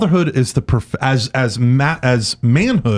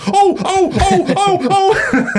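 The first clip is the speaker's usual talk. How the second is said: alarmed grandmother; much slower than usual. Retold or imitated. Host imitating the grandmother behind his laboring wife. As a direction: gasp in mock alarm like an old lady. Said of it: "she goes".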